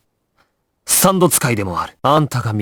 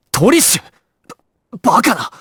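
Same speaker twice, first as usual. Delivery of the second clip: urgent shout; 9 semitones higher than usual. Acted. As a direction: yell her name in alarm. Same clip repeated. (驚きの叫び)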